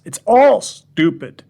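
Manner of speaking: slow, loud and pointed; slow scornful declaration; emphatic outburst. Acted. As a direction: hammer out each word.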